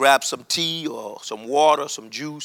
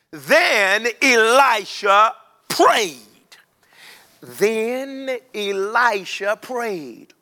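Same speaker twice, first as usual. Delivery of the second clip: drawn out and higher, savouring it; slow dramatic build; 4 semitones higher, much slower than usual. Acted. Preacher lingers on Elisha's prayer, slow and rising. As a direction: stretch each word for suspense.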